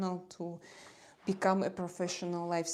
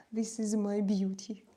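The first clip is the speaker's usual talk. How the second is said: ordinary delivery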